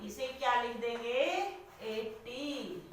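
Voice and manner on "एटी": very slow and much softer; hushed, stretched number for the class; soft drawn-out dictation. Shown as a demonstration. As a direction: let the word trail out slowly and softly. Teacher dictating digits while writing.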